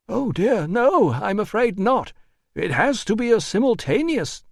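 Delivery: high, fussy refusal; prissy administrator. A narrator's reading. Administrator refusing the doctor's terms, high and prissy. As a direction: decline fussily, pitched high.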